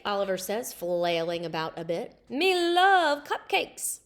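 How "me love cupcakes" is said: slow, louder monster voice; Cookie Monster voice. A narrator's reading. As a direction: ham up the greedy monster. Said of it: "Cookie Monster who talks like that".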